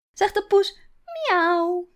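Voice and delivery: high, loud sing-song cat sound; playful meow. Acted. Grandmother meowing for Iris, high playful cat voice. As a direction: play the kitty for the toddler.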